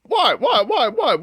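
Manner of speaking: high, baffled what repeated; protest, bewildered; confused protest. Real, not acted. Accused, protesting innocence.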